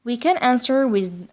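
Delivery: usual speaking voice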